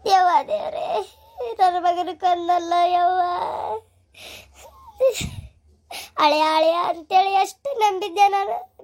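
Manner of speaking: slow, sobbing cry to God over a lost son; keen it out slowly, voice breaking with tears; mother's slow crying lament for her son; wailing lament. Acted.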